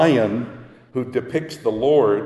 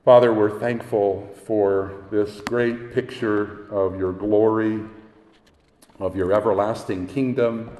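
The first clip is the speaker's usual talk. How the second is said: closing prayer; 6 semitones lower than usual. Acted.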